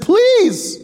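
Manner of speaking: very high, strained begging voice; pleading Amos. Retold or imitated. Preacher acting Amos begging God to forgive, high and strained. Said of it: "He said".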